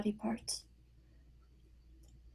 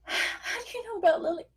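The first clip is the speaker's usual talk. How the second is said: high, strained, near-crying disbelief; shocked; 10 semitones higher than usual. Acted. Sue hears her daughter's name from Yates, shocked and tearful. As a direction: blurt it out in shock, voice cracking. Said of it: "Sue reacts with shock".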